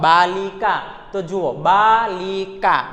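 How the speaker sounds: બાલિકા drawn out slowly, syllable by syllable; pronunciation demonstration. Shown as a demonstration. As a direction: stretch the vowels of બાલિકા, ending on long aa. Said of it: "હવે આપણે ઉચ્ચાર કરીએ શબ્દનો".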